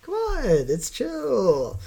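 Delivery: slow drawn-out chill voice; lazy whiny dude; mock tourist voice.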